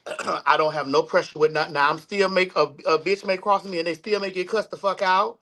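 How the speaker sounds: normal talking voice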